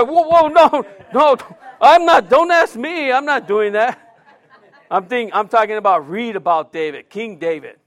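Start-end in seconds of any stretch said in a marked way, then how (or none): 0.0-4.0 high, loud, hammed-up denial; mock protest. David in the congregation protests he is innocent, high loud mock panic. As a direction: pretend to be the man in the pews backing off.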